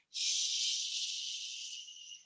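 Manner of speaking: whispered shush; hushing whisper. Real, not acted. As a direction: hush the class so the giant won't hear. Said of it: "Gotta be as quiet as you can".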